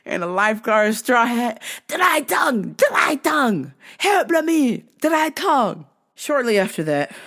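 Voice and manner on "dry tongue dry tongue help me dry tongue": put-on higher, odd foreign-accented customer; earnest lady with a strange request; lightly accented old lady. Lady pleading again.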